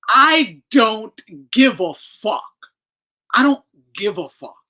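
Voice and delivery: slow, loud and deliberate; loud, spaced-out declaration; emphatic. Real, not acted. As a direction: lean on every word. Ranting at Haney's defenders.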